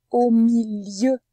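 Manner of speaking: "milieu" loud and stretched out; slow, emphatic "milieu", both syllables drawn out; syllable demonstration. Shown as a demonstration. Teacher shows where the sound is, slow and emphatic on "milieu". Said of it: "dans la deuxième syllabe de milieu".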